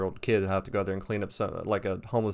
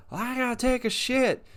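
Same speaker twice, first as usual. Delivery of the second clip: mocking homeless man. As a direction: imitate him in a squealy high pitch. Homeless man's announcement, retold.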